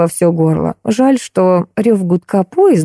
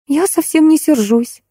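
put-on high, slow girlish voice, not truly whispered; gently hushed-sounding reply, pitched well up; whispering blonde; 9 semitones higher than usual. A narrator's reading. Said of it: "зашептала блондинка". (робкий высокий шёпот вполголоса)

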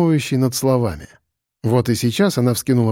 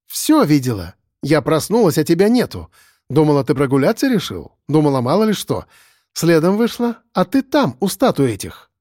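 higher, climbing as the memory comes back; agitated young woman; 4 semitones higher than usual. Acted. Lyusya recounts following Sandro that night, agitated. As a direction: tell it breathlessly, reliving the night.

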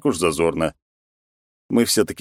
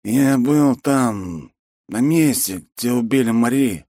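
put-on slow drunk confession; 6 semitones higher, slower than usual. Drunk man's revelation.

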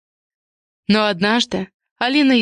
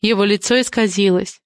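usual speaking voice

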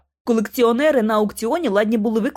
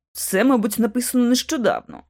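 usual speaking voice

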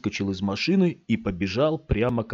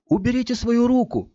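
sharp demand; 4 dB louder than usual. A narrator's reading. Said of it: "уже более низким голосом".

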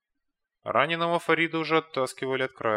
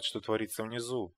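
ordinary delivery

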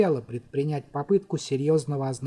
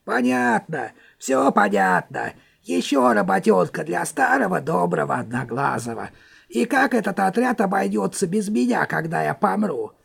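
put-on put-upon old grouch, whining; grumbling old wizard; 3 semitones higher than usual. A narrator's reading. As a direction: sigh it out like a martyr. One-Eye grumbling complaint.

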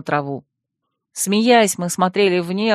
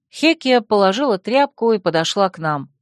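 plain reading voice

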